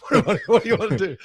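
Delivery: high, laughing mock girlfriend; breezy dismissive girlfriend. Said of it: "her reaction was like".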